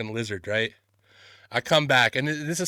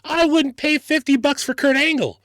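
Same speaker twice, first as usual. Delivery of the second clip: high, loud, vehement protest; indignant rant; 12 semitones higher, 5 dB louder than usual. Real, not acted. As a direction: protest the price at the top of your range. Ranting about the photo price.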